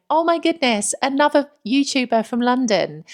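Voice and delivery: high, loud and thrilled; excited comment. Retold or imitated. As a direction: gush like a delighted fan. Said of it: "commenting on her video going".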